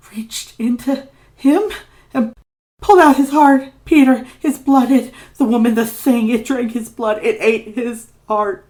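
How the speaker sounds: high, loud, near screaming; terrified outcry. Acted. Ignatius reliving his father's death, terrified outcry. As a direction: cry it out as the memory overwhelms him. Ignatius reaches the horror's peak.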